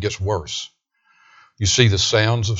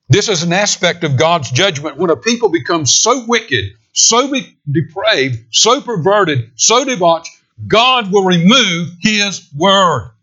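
higher, louder, slowing to a cry; preaching at full force; 7 semitones higher, 5 dB louder, slower than usual. Acted. Preacher warning of God's judgment, climbing to a loud cry. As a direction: build to a preaching peak.

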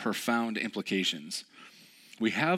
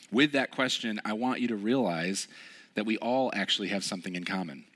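normal talking voice

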